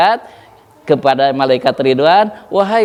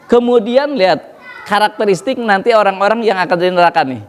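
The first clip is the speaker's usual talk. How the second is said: ordinary talk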